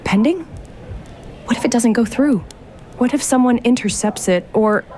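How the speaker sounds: anxious. Acted. Lauren fretting that the transfer gets intercepted, anxious. Panicking over pending transfer.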